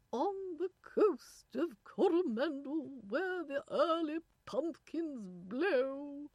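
loud, high, slow English nanny reciting verse; pronounced English accent. A narrator's reading. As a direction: recite grandly in exaggerated English tones. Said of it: "a woman with a pronounced English accent".

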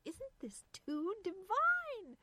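high, slow, thrilled drawl; delighted gushing. A narrator's reading. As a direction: gush with drawn-out glee. Irene delighted at eavesdropping.

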